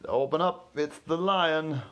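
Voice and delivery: slow, loud growly lion at the door. Acted. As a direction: announce yourself like a lion.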